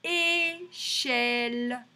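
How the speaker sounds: Échelle very slow, both syllables held level; long É, then long CHELLE; drawn-out syllables. Shown as a demonstration. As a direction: stretch Échelle for careful listening. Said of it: "chercher si on entend".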